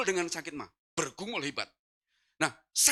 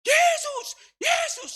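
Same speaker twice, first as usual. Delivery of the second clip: very high, loud cry for breath; desperate yell; 7 dB louder, much slower than usual. Retold or imitated. Man waking breathless calls on Jesus, desperate yell. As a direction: scream the name as if suffocating. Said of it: "saya teriak Yesus".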